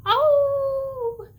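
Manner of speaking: loud, high, long howl; wolf-like howl. Shown as a demonstration. Leader shows children howling with a friend, loud howl. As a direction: howl out high and let it sink slightly. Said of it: "You can howl with a friend".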